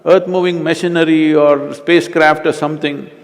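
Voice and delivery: loud, high exasperated retort; retold exasperation. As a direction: act out fed-up protest at needless fussing. Retelling his exasperated retort.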